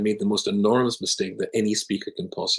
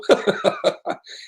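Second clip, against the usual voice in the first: big laugh much higher in pitch; British belly laugh; 12 semitones higher than usual. Shown as a demonstration. Rabbi contrasting British and Swiss laughter, hearty laugh. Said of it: "you'll go".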